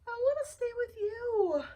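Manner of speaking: high pleading rose voice; tearful plea. Acted. Wanda begs Flytrap to let her stay, high and tearful. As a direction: beg to stay, near tears.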